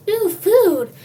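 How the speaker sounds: high, loud delighted cry; thrilled at a find; excited. Acted. Hungry kid spotting a cheeseburger, loud and excited.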